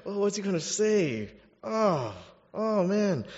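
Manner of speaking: high-pitched groaning worry; mock dread. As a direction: dread his next words, playfully acted. Imagined reader dreading Peter's words.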